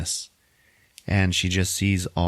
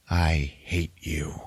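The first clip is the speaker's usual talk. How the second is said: slow, measured mock-villain delivery; savoring the words; 2 semitones lower, 3 dB quieter, much slower than usual. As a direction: draw out each word deliberately. Joker savours three little words.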